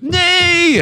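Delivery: very high shrill "Nay"; hammed-up pirate cry. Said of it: "hold on to the poop deck".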